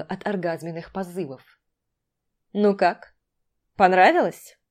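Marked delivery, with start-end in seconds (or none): 2.5-4.6 cocky, provoking question; challenging. Acted. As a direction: taunt her with it, loud. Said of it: "С вызовом спросил Дима".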